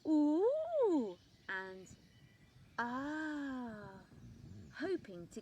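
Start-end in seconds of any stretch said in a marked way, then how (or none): 0.1-1.2 loud, high, long showing-off ooh; showy ooh shout. A narrator's reading. Top Leaf swinging on his stalk, loud showing-off ooh. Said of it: "swing around on his stalk shouting". 2.8-4.0 long drawn ah; showy ah. A narrator's reading. Top Leaf swinging on his stalk, drawn-out ah. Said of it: "swing around on his stalk shouting".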